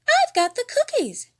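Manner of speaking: very high, loud chirpy offer; high diva offer; Piggy. A narrator's reading. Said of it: "added Piggy".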